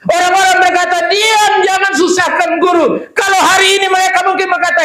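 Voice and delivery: shouted, driving story preaching; fiery storytelling from the pulpit. Acted. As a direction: tell Bartimaeus's story at full volume. Preacher's retold Bible story at full force.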